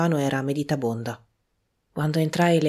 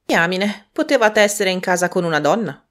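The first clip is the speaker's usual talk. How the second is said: higher and louder, teasing woman; teasing Prudence; 6 semitones higher, 8 dB louder than usual. A narrator's reading. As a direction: needle him with a cheeky jab. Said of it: "rispose Prudence".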